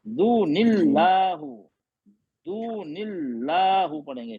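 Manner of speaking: दू निल्लाहु slow, nun with zer linking; joined recitation. Shown as a demonstration. Teacher demonstrates joining the verses slowly. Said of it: "नून जेर के साथ लगा देंगे".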